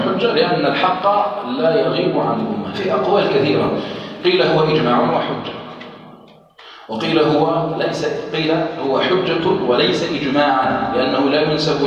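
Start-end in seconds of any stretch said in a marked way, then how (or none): none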